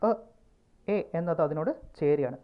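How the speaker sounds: ordinary talk, nothing marked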